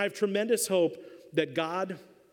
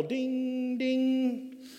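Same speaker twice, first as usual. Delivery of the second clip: bell ding; 6 semitones higher than usual. Retold or imitated. Imitating the ring bell.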